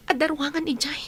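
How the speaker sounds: ordinary talk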